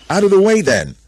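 high, loud order; commanding shout. A narrator's reading. Adam ordering the animals aside, commanding shout. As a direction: shout them out of your path.

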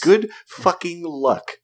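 high, drawn-out mock good wishes; scoffing send-off; sarcastic good luck. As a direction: punch each word slowly, loudly, with disbelief. Mock wishing luck on impossible odds.

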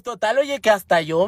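usual speaking voice

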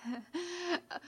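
breathy nervous laugh; shaky huffed laugh. A narrator's reading. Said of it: "huffs out a shaky laugh".

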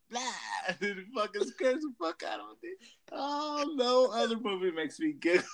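normal talking voice

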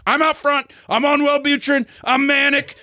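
high, shouted manic rant; manic excitement. Retold or imitated. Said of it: "He's like".

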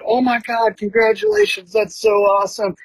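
high, gushing mock voice of the proud husband; imitating the husband. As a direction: gush congratulations in a high put-on voice. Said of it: "And he's like".

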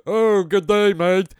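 hammed-up Australian accent greeting; a jeering Australian accent; mock Australian accent.

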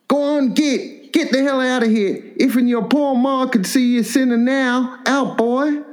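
put-on old hunchback father throwing his son out; angry old hillbilly pa. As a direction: drive the boy out with a hammed-up rasp. Old Pa chasing boy out.

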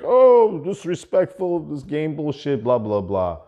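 high, loud, outraged imitation of the buddy; mock annoyed friend. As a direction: squawk it like a fed-up friend.

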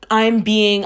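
usual speaking voice

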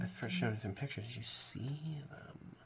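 breathy mock-sultry whisper. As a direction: whisper it like a dirty secret. Mock-sultry whispered bow talk.

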